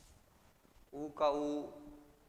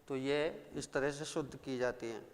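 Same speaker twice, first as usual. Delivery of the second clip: ordinary talk, nothing marked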